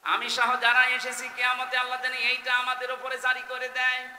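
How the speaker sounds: high, loud impassioned wish; fervent preaching. Real, not acted. Preacher wishes God's pardon for all gathered, high loud appeal. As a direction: lift the plea high and hold it there.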